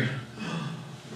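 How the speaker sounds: breathless, voiceless gasp; a quick gasp of dismay; a sharp gasp at the mistake; mock gasp. Reliving the dropped-nut panic.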